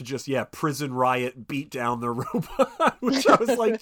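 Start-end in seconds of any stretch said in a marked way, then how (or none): none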